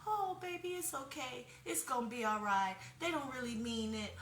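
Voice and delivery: high, soft coddling voice; a sugary, babying reassurance; mock soothing mom. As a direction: coo it like a mom comforting a baby. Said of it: "better than saying".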